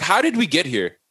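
high-pitched incredulous questioning; exasperated disbelief. Retold or imitated. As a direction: protest the absurdity, pitch riding high. Exasperated reaction to absurd plot.

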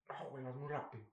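low, soft let-down; acted rejection. Acting a partner declining to formalize, quiet rejection. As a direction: turn them down quietly and low.

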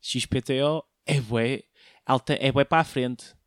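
ordinary talk, nothing marked

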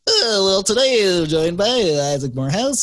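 lightly voiced podcast host; mock the formal host intro. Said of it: "I'm glad you didn't do the".

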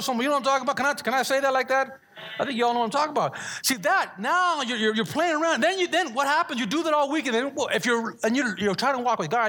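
lively sermon delivery, driving forward; preaching. Acted. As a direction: rouse the congregation, pitch lifted. Preaching builds up energetically.

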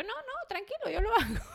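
high sugary mock-sweet reassurance, laughing; fake sweetness. Wife to husband, pretending all is fine, high sugary voice. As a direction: chirp it sweetly while hiding annoyance.

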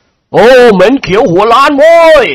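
loud, high, slow jeering yell; jeering shout. A narrator's reading. Student jeering at the bald old nobleman, loud slow yell. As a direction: yell an insult across the yard. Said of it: "ร้องตะโกนขึ้นทันที".

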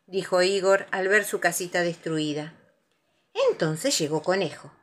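plain reading voice

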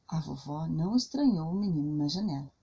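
plain reading voice

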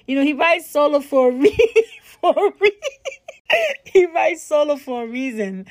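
words breaking up with real high laughter; laughing through it. Real, not acted. Vlogger joking about her date, laughing helplessly. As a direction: giggle your way through the punchline.

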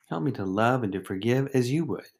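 ordinary delivery

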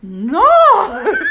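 loud, laughing gasp of disbelief; shocked no. Retold or imitated. Shocked reply to mother-in-law.

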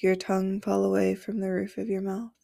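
usual speaking voice

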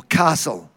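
"castle" with a long ah, like "cahstle"; West Australian pronunciation. Shown as a demonstration. Another word he needn't say, "castle" with a long ah. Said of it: "I don't have to say".

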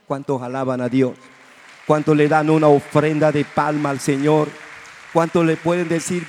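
loud call for an offering of palms; preacher urging praise. Real, not acted. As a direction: rouse the congregation.

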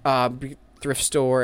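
usual speaking voice

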